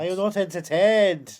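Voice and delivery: booming hammy gladiator; Maximus impression. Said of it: "I am Maximus".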